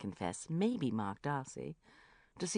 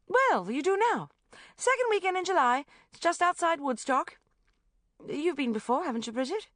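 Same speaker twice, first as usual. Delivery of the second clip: high, loud bossy brightness; bossy gush; 13 semitones higher, 8 dB louder than usual. A narrator's reading. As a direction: steamroll them cheerfully. Rebecca steamrolling.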